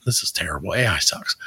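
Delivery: ordinary delivery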